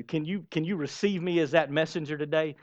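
ordinary talk

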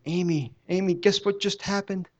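high, eager call to his wife; excited call. Retold or imitated. Telling his wife about the healing, high and excited. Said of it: "I came undone".

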